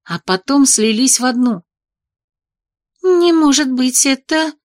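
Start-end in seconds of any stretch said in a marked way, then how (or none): 3.0-4.5 high, stunned gasp of disbelief; shocked disbelief. A narrator's reading. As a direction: cannot believe her eyes. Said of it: "Бри ошарашенно глянула".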